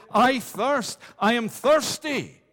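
loud, high cry of thirst. Acted. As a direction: call it out like a desperate cry from the cross. Christ cried, preacher voices it.

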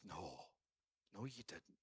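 very soft, low reply; quiet, low-pitched correction; hushed contradiction. Acted. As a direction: gently say it almost under the breath. Paul's gentle contradiction, acted softly.

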